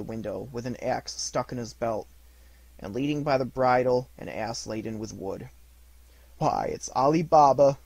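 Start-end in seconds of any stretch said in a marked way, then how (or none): 6.4-7.8 louder, delighted cry of recognition; ecstatic exclaim. A narrator's reading. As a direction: burst out with joy. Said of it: "Scrooge exclaimed in ecstasy".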